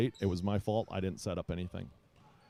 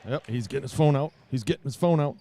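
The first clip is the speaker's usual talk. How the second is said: loud, higher-pitched running commentary; real excitement, raised and loud; excited commentary; 4 semitones higher, 10 dB louder than usual. Real, not acted. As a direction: call it like a big play. Watching hopefully for text to land.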